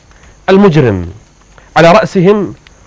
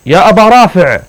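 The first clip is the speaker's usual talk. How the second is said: calling his name out in the dark; a retold shout, raised a bit; mock call out; 5 semitones higher, 4 dB louder than usual. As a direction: call the name loud to make him answer. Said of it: "فصرخت وقلت".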